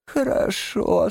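high, slow, feigned frail lady; Toad posing as washerwoman, feeble. A narrator's reading. Toad plays invalid for the gentlemen, feigned frailty. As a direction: agree meekly in a high, slow put-on voice.

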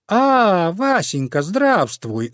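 high, sugary fox hello; smiling greeting. A narrator's reading. Fox greets the cat she knows, smiling. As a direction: greet him high, with a smile. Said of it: "заулыбалась лиска Лариска".

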